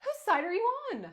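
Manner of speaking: high, loud indignant question; indignant challenge. Acted. Mother challenging the principal's loyalty, indignant.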